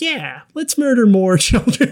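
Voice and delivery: high, loud cheer with a laugh; mock gleeful. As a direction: cheer it on with over-the-top delight. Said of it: "very gleefully being like".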